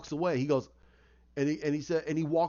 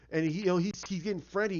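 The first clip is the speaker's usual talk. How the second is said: plain reading voice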